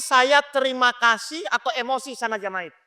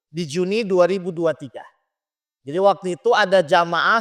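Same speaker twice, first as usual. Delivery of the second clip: normal talking voice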